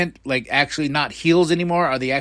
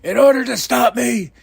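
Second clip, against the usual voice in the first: raised, emphatic mock promo shout; imitating Jarrett; 7 semitones higher, 4 dB louder than usual. Wrestler defying his rival after being knocked down, imitated defiant shout. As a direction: call it out high and drawn out. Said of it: "he's like".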